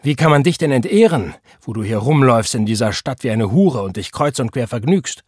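high, loud explosion of scorn, then easing; indignant outburst that settles into accusation; outraged cry. A narrator's reading. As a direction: burst out on the first word in contemptuous disbelief. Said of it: "rief er".

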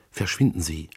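whispered, voiceless and hurried; a breathless whispered warning; urgent whisper. Acted. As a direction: hiss the order under her breath. Urgent plea to leave.

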